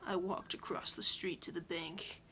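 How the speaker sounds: spooky hushed whisper. Acted. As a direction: whisper the story to scare them. Reading horror story in whisper.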